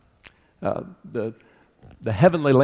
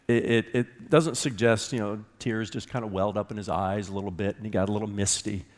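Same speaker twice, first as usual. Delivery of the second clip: ordinary talk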